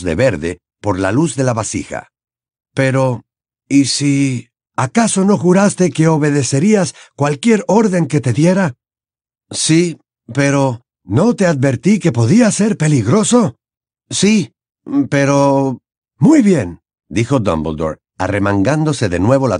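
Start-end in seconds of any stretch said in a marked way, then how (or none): 2.7-15.8 higher-pitched, animated character dialogue; story dialogue, raised pitch; Harry and Dumbledore in argument. A narrator's reading. 16.2-16.8 high, louder character voice; firm old headmaster; Dumbledore, decisive. A narrator's reading.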